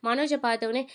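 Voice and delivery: usual speaking voice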